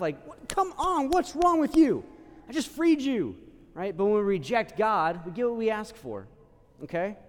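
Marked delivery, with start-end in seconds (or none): 0.3-3.4 high, incredulous outburst; exasperated. Retold or imitated. God scolding the ungrateful Israelites, high and exasperated. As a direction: throw up your hands in disbelief.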